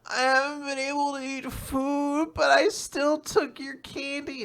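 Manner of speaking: high, slow little-girl sob, lightly played; mock sobbing girl. Sick little girl apologising to her doctor, mock sobbing. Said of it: "And she's sobbing".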